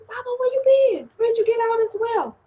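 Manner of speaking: eager greeting to an elder; a hint of a younger man's excitement; lightly voiced Ogun. Ogun greeting Obatala, lightly eager. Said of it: "He said".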